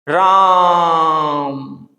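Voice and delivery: very slow, stretched wailing call of Ram; long drawn-out cry. Shown as a demonstration. Teacher demos Dasharatha's dying cry, stretched long. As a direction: drag the name out like a dying king. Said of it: "ऐसे करके लंबा बोलते हैं ना".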